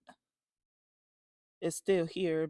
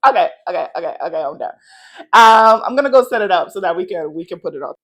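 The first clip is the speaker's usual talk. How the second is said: buzzing with excitement, nearly yelling; excited loud talk; 3 semitones higher, 14 dB louder than usual. Real, not acted. Vlogger excited to hang the art, talking loud. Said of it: "I was yelling over there".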